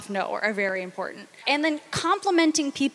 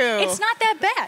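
dismissive, grudging praise; backhanded reassurance; 5 semitones higher, slower than usual. Retold or imitated. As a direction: shrug it off as faint praise. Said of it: "It was like".

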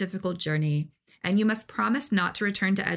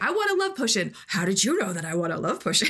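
imitating Rankle; faster than usual. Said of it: "he's like".

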